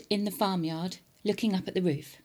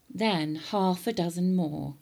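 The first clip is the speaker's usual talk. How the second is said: plain reading voice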